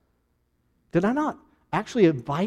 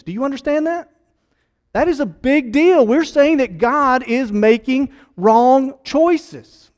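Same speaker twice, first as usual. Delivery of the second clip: louder, higher, hammering home the point; fiery preaching; 5 semitones higher, 4 dB louder than usual. Acted. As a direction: press it on the congregation with force. Preaching at full force.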